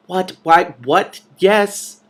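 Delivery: plain reading voice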